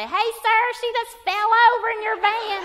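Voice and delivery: high, loud mock alarm; bystander calling out. Woman in parking lot alerting husband, imitated high holler. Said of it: "some idiot woman in the parking lot say".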